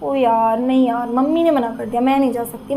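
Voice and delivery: low, dejected plea with little movement; sad complaint. Shown as a demonstration. Teacher shows sad talk about mummy saying no, low and dejected. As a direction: demonstrate disappointment, dropping the pitch. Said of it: "जैसे हम बोलते हैं ना कोई दुख वाली बात".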